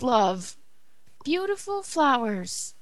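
ordinary delivery

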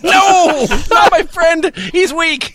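high, loud mock panic; hammed-up wail of alarm; mock distress.

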